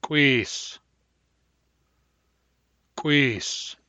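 glottalized, rounded kw in "Quees"; hard round K. Shown as a demonstration. Teacher giving the hard round K example, glottalized rounded "Quees". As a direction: pop the k of "Quees" with rounded lips and closed glottis. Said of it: "Hard round K".

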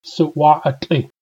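Suwa'atli said slowly and deliberately, falling at the end; slow model command. Shown as a demonstration. Teacher modelling the command form Suwa'atli, slow and clear. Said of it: "I could also make it a command".

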